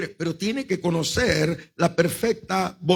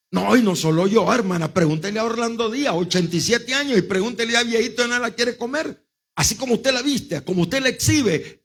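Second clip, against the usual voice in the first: high, insistent retold retort; indignant retort; 5 semitones higher than usual. Pastor answering the mother's accusation, indignant. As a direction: throw the accusation back, pitched up.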